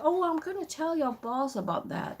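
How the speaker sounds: high mock-indignant threat; pissed off, imitated. Customer threatening to tell the boss, mock pissed off. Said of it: "very pissed off".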